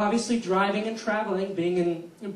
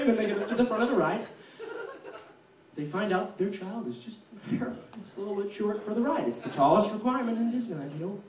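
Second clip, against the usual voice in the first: ordinary talk, nothing marked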